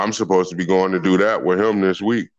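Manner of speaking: normal talking voice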